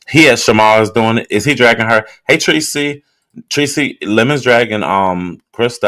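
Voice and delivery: loud, high, eager calling out to Tracy; rushing to tell Tracy the gossip; excited. Real, not acted. Streamer loudly calls to Tracy about the drama, excited.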